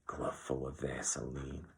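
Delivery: slow, hushed disgust. A narrator's reading. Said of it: "he said disgustedly".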